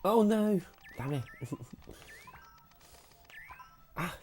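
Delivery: ordinary talk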